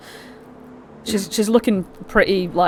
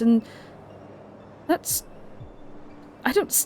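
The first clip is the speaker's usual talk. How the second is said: ordinary talk, nothing marked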